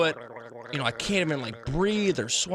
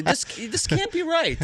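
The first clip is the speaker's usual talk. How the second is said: much higher, slower pleading disbelief; incredulous protest; 11 semitones higher, slower than usual. Retold or imitated. Pleading with the doctor over the chart, high and incredulous. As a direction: beg the doctor to say it's wrong.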